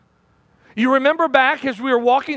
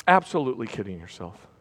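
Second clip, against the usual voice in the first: hushed warning; 12 semitones lower, 6 dB quieter than usual. Real, not acted. Preacher repeats the warning, softer.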